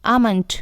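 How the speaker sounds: आमंट with no l sound; long ah straight into m in आमंट; silent l. Shown as a demonstration. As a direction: say आमंट as "AH-mund". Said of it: "यहाँ एल silent है".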